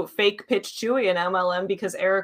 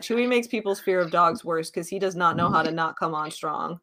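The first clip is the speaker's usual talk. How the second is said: usual speaking voice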